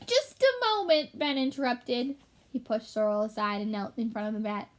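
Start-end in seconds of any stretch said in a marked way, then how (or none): 0.1-1.2 interrupting. Acted. Ben interrupts.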